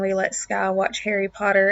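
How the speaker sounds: normal talking voice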